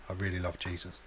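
low, very soft deadpan confession; mock-sincere, hushed and low; feigned devotion. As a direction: quietly fake a love of Jesus.

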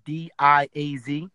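slow, letter by letter; spelling it out. Shown as a demonstration. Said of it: "spelling surname out".

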